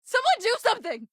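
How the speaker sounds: high-pitched, desperate calling out; panicked plea. Acted. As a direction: beg bystanders to step in. Bystander cries for help.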